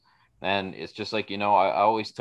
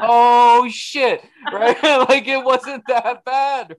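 much higher, louder, slow laughing realization; a sudden laughing aha, high and slow; mock realization; 8 dB louder, much slower than usual. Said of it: "you're like".

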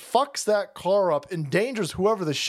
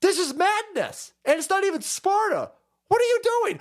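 high, exasperated disbelief; incredulous exclamation; 10 semitones higher than usual. Real, not acted. Host appalled at the madness on screen, incredulous. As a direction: exclaim it, climbing in pitch.

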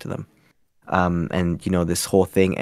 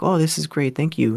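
higher-pitched mimicked thanks; imitated grateful thanks; 7 semitones higher than usual. Radio station staff thanking the volunteer, imitated gratitude. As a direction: lift the pitch for a mock grateful reply.

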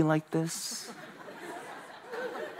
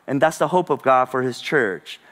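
ordinary talk, nothing marked

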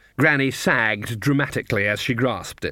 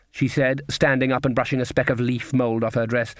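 plain reading voice

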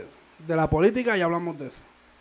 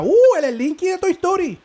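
high, loud imitated squeal; mock delighted surprise; 12 semitones higher, 7 dB louder than usual. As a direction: act out her excited discovery. Voices woman's delighted reaction.

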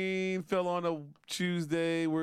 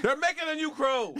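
excited shout; 10 semitones higher, 4 dB louder than usual. Real, not acted.

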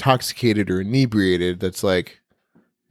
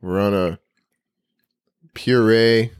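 slow deadpan; slower than usual. Real, not acted. Joking deadpan about diet.

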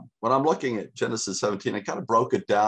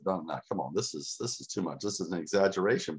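low, soft doubting inner voice; hushed skeptical inner voice; quiet inner doubt; 3 semitones lower, 6 dB quieter, faster than usual. Retold or imitated. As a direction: mutter the doubt to yourself. Voicing his own doubting mind.

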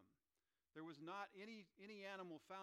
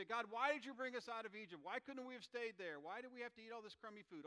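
loud, higher whiny complaining; grumbling complaint; 8 semitones higher, 9 dB louder than usual. Acted. Israelites grumbling to God in the wilderness, loud and whiny. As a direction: whine it like ungrateful grumblers. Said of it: "they start to grumble".

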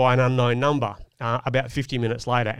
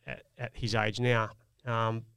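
usual speaking voice

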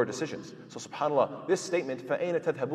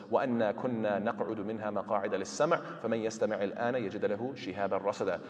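fast, running recitation of the verse; quick Quran recitation; 3 semitones lower, much faster than usual. Acted.

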